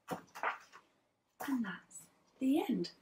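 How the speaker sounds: put-on lively read-aloud for young children; teacher reading a picture book to her class; storybook narration. A narrator's reading. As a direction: tell it like a class story time.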